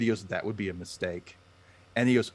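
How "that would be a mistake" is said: low, soft cool warning; quiet threat. Retold or imitated. New kid quietly warning the bully, soft menace. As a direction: calmly warn the bully off.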